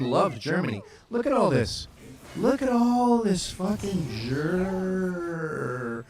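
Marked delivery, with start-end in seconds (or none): 1.1-1.9 loud, higher-pitched "look at all this"; excited exclamation. Real, not acted. Pointing excitedly at the map. 2.4-6.0 loud and high, words stretched very long; slow, booming marvel at the sight; drawn-out amazement. Real, not acted.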